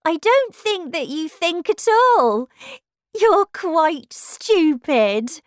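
high, loud, haughty put-down; rude. Acted. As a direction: insult them as a snooty rose. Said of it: "said the rose rudely".

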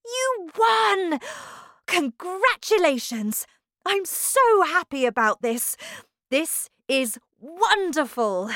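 high, loud, elated congratulations; overjoyed. A narrator's reading. Wonka congratulating Charlie on winning, overjoyed. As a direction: gush with delight. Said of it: "cried Mister Wonka".

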